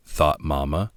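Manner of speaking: plain reading voice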